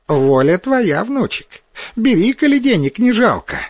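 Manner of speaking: put-on high, delighted old granny voice; a granny glad of her luck; pleased old woman. A narrator's reading. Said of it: "обрадовалась старуха такой удаче".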